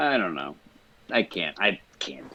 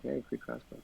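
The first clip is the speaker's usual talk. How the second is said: sly whisper; 7 semitones higher, 11 dB quieter than usual. Retold or imitated. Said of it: "just be like".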